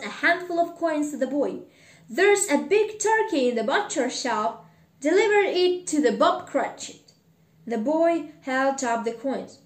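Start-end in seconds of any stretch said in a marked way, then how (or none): 2.1-7.1 louder, raised, eager voice; excited instructions. Acted. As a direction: call out an order with delight. Scrooge gives excited orders from window.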